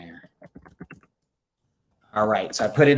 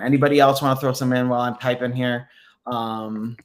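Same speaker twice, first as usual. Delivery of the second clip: usual speaking voice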